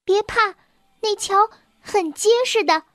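high-pitched little mouse calling after someone. A narrator's reading. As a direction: call out reassurance in a high little voice. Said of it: "老鼠妹妹在他身后喊着".

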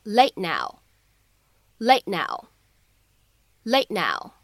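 "late now" with the t held, unreleased; joined "late now", no burst on the t; incomplete plosion. Shown as a demonstration. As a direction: stop the t of "late now" and move straight to the n. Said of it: "我们可以有一个不完全爆破的处理".

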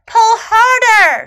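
high, loud, drawn-out shout. A narrator's reading. Snake urging little elephant to pull, shouting. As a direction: stretch the command into a rallying shout. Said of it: "shouted Snake".

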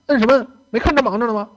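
high, loud irritated scolding; rude snapping. Retold or imitated. Attendant scolds thirsty passenger ringing the bell, loud irritated snap. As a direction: snap at the pest who keeps ringing.

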